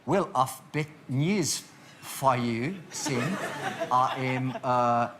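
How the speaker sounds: slow, halting stab at South African; South African accent. Acted. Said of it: "he's made it South African".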